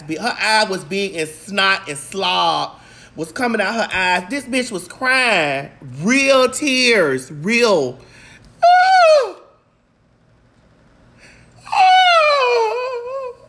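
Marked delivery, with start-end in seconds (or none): 4.3-8.0 slow, drawn-out "real tears"; "real" said slowly, each one weighty; emphatic stress. Real, not acted. Insisting the tears were real. 8.6-13.4 very high, long crying "oh"; hammed-up high-pitched howl; mock wail. Imitating the crying woman.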